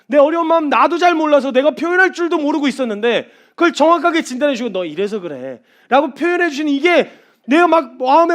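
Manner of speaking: loud, high, impassioned sermon cry; full-force preaching. Acted. Preacher crying out to the congregation, impassioned and loud. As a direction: preach it out with rising fervor.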